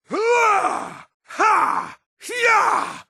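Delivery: very high shouted combat efforts; short yells thrown with each strike; attack cries. Acted.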